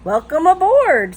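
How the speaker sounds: high, loud showy welcome; hearty cruise director. Acted. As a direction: greet a whole crowd grandly. Cruise director greets passengers.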